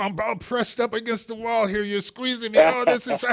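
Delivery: high, loud strangled mock distress; hammed-up cry of being squeezed; being squeezed hard. Pretend being strong-armed.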